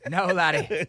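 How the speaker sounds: mock Scottish-ish accent. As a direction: roll out "No, laddie" in a put-on brogue. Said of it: "not, not English".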